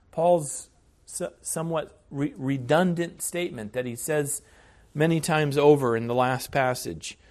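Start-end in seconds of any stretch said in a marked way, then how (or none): none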